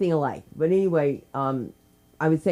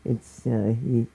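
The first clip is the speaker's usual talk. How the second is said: usual speaking voice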